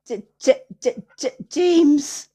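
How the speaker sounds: high stammering, tripping over the name; starstruck stammer. Retold or imitated. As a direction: stutter the name like a starstruck boy.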